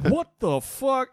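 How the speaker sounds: retold flabbergasted outburst; shocked disbelief. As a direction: stretch each word in appalled amazement. Retold shock at film scene.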